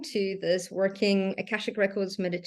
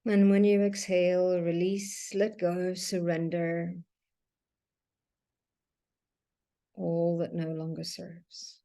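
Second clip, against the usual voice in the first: guided meditation. Acted. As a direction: guide them gently through each breath, leaving long silences. Leads a breathing meditation.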